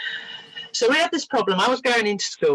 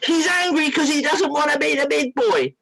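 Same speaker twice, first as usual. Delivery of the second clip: retold indignant shout; cross little boy; 9 semitones higher than usual. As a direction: imitate an exasperated child snapping back. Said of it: "Peter got so cross with me".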